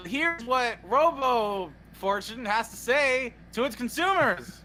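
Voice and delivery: slow, drawn-out announcer delivery; grandly stretched pitchman voice; fake ad read. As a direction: announce it like a parody commercial. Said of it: "time for our fake ad of the day".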